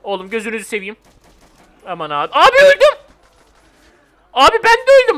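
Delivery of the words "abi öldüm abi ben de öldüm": high loud wail of defeat; real outcry. Real, not acted. Player yelling to viewers that he just died, high outcry. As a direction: cry out as you go down. Character dies in game.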